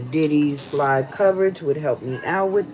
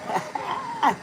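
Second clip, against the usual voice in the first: laughing; 5 dB quieter than usual. Real, not acted. Laughs at own joke.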